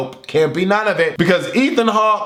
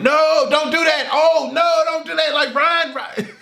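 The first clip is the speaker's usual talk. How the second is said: panicked warning at the screen; 10 semitones higher than usual. Retold or imitated. Yelling at the film's hero.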